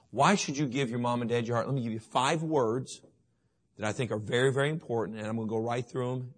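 normal talking voice

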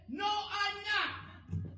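high, slow, drawn-out mock yell; hammed-up shout. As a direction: shout the denial back, stretching it out. Roy's retold denial, shouted.

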